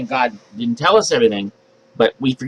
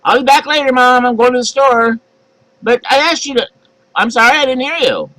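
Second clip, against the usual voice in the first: put-on dodging kid; 9 semitones higher, 3 dB louder than usual.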